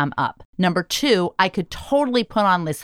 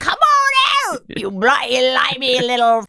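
mock English accent; 6 semitones higher than usual. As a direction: coax the worms in an exaggerated English accent. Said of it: "how good is your English accent?".

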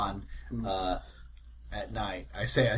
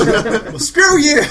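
laughing, higher and louder; 13 semitones higher, 12 dB louder, much slower than usual. Real, not acted. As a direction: laugh through the retort. Laughing retort.

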